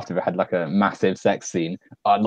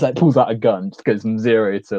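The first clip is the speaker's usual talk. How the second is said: ordinary talk, nothing marked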